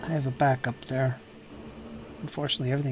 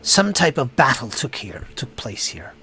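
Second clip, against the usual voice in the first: dramatic narration with heavy stress and drops; game master's scene setting; 6 dB louder than usual. Acted. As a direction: set a grisly scene, lingering on key words.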